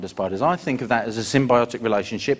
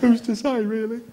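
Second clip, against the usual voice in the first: mock airy reply; 9 semitones higher than usual. His own reply to spider.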